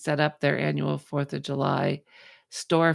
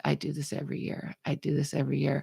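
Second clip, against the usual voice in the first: flat store owner; 6 dB quieter, faster than usual. Retold or imitated. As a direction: shrug it off quietly. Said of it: "And he goes".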